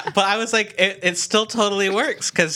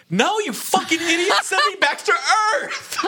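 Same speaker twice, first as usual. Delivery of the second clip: exasperated yell; 12 semitones higher than usual. Retold or imitated. Imagined furious dad quote.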